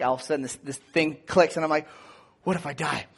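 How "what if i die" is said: panic praying. Retold or imitated. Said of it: "panic praying".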